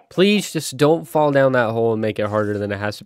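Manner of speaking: ordinary talk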